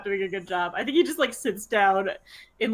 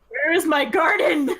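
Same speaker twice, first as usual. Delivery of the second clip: anguished wail; 5 semitones higher, 4 dB louder, slower than usual. Acted. Ember cries out over his garden.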